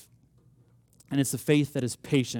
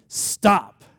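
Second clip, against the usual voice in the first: high, loud shout of stop; yell; 7 semitones higher, 8 dB louder than usual. A narrator's reading. God halting Abraham's knife, a loud shout. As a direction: shout it down from heaven. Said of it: "God yells down from heaven".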